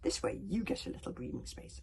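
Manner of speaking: usual speaking voice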